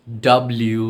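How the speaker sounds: "ডাব্লিউ" run together as one word; connected, emphatic "ডাব্লিউ"; joined-up letter W. Shown as a demonstration. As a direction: join the parts of "ডাব্লিউ" smoothly. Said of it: "একসাথে বললে".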